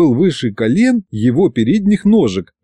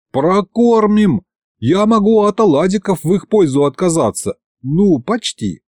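put-on high earnest boyish insisting; boy promises to feed the mammoths; eager promise; 7 semitones higher than usual. A narrator's reading. High eager pleading.

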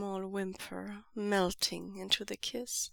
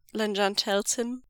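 ordinary delivery

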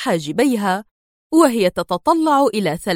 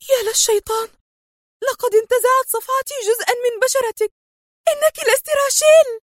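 shocked cry. A narrator's reading. Sonia crying out in shock at the torn mask. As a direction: gasp it out as the mask gives way. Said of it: "وهي تهتف".

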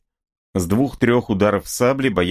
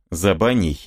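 ordinary delivery

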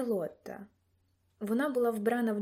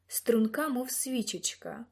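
ordinary delivery

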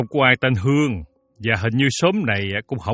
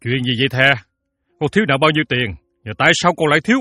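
a touch higher, anxious questioning; impatient; 4 semitones higher, much faster than usual. Acted. Father breaking in to question his indebted daughter, impatiently. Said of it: "ông Sáu quá sốt ruột".

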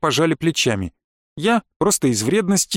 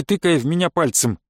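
ordinary delivery

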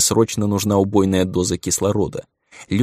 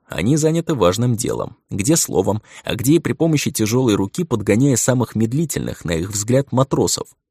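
ordinary delivery, nothing marked

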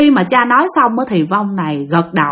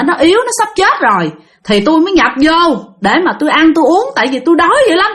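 starving ghost wail; 6 semitones higher than usual. Retold or imitated. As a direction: wail like a starving spirit begging for food. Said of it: "bả nói vậy nè". (giọng ma đói than van, cao và to)

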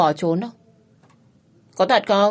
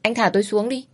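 hasty reply; 6 semitones higher, 4 dB louder than usual. A narrator's reading. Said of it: "vội vàng đáp".

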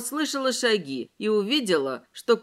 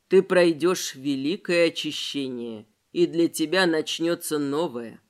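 low, drawn-out hypnotic voice; slow hypnotist voice; 6 semitones lower than usual. Acted. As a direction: lull her like a hypnotist, slowly. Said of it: "тягучим гипнотическим голосом".

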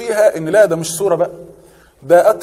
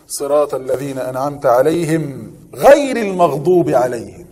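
slow, drawn-out recitation of the verse; Quran recitation; 4 semitones lower, slower than usual. Shown as a demonstration. Teacher reciting a verse for the class, slow and drawn out. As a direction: stretch each word like a reciter.

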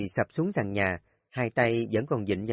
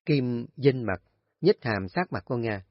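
usual speaking voice

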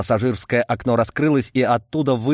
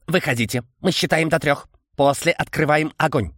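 chittering insect voice, higher; стрекочущий голос; 6 semitones higher than usual. A narrator's reading. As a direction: click out the threat like an insect. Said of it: "Какой же странный голос, стрекочущий". (стрекочущий голос насекомого)

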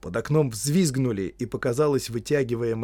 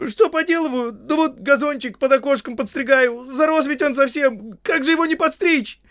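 very high, loud, flustered excuses; comic frightened gardener; pleading servant; 9 dB louder than usual. Acted. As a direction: squeal out innocent excuses. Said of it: "взмолился Сэм".

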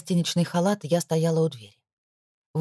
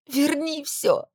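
tearful high cry; 10 semitones higher, much slower than usual. Acted. Истерика героини.

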